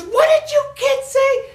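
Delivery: very high, loud angry shout; outraged mother confronting the kids; furious mom. Retold or imitated. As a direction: Latin mama shrieking at the kids. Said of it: "She goes".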